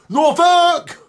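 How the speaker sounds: high loud yell of Norfolk; shouting Norfolk. Retold or imitated. In Norfolk you can yell it too, high and loud. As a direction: bellow Norfolk like Suffolk. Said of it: "you can say".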